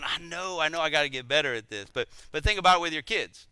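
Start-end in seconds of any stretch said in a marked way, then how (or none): none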